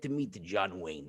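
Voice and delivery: put-on low, soft dreamy old man; old father. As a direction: sigh it out low. Father's wish about John Wayne.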